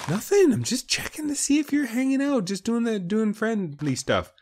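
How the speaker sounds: high, sing-song innocent; feigned innocence. Pretend. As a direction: play it like you're hiding something.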